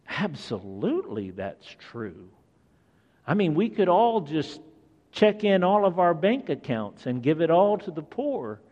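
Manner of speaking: ordinary talk, nothing marked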